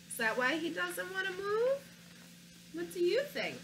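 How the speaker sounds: plain reading voice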